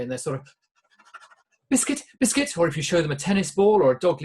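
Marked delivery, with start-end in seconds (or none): 1.7-2.6 high yappy chihuahua voice, much higher; excited little dog. Retold or imitated. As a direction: yap it like a dog begging for a treat. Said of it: "they get very excited about it".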